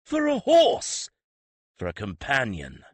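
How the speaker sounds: a high, loud, stretched-out cry of disbelief; shocked outrage. Acted. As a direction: gape and shout it in shock. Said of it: "the prince gaped at him in shock".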